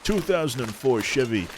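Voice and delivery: a hint of an American car-ad announcer; mild car-advert pitch; car commercial voice. Shown as a demonstration. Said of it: "like an, uh, an American sort of car commercial".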